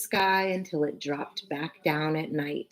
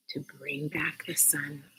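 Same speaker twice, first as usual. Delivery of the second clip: whispered; 9 dB quieter than usual. A narrator's reading. Reading Usha's note.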